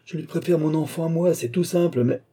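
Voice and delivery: high-pitched, heated protest; heated exclamation. A narrator's reading. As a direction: cry it out in self-defence. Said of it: "s'écria monsieur de Beaupréau".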